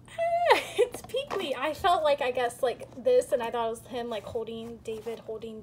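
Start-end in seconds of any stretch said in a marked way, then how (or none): 0.1-0.6 excited shriek. Real, not acted. Shrieks at rare figure.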